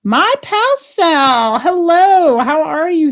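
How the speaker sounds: high, slow, cooing hello; sing-song greeting. Pretend. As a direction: greet it like an old friend.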